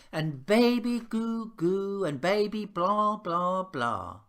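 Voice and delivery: slow, sing-song mimicry of cooing grown-ups; a lightly voiced send-up of adults gushing; half-hearted mock cooing at a baby; mocking baby talk. Mocking adults fussing over baby.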